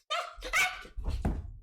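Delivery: high, strained gasping yelps; panicked gasps. Retold or imitated. Kid hanging by stuck thumb, panicked gasping. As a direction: gasp and squeal in mock panic.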